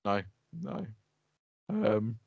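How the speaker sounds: ordinary talk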